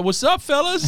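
high, goofy, breezy greeting voice. Retold or imitated. Big guy strolls in greeting the team, high and goofy. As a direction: breeze in with a silly high hello. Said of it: "he walks in, he's like".

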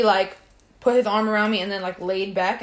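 normal talking voice

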